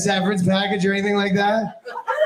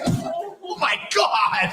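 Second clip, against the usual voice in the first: 1 shocked coworkers; 8 semitones higher, much slower than usual. Retold or imitated. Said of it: "They were like".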